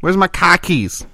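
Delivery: slow, high mock Boston accent. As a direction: play a Boston guy losing his keys. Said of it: "Boston accents".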